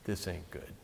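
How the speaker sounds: low, hushed aside to himself; Pharisee's private, low-voiced thought; muttered inner thought. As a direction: think it quietly under your breath. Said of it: "said in his mind".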